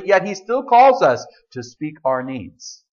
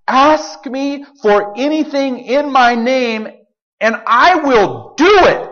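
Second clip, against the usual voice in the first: slow, higher emphatic promise; 5 semitones higher, 3 dB louder, slower than usual. Retold or imitated. Preacher quoting Jesus's promise, slow and emphatic. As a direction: hit "ask" hard and let it land slow. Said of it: "It's emphatic".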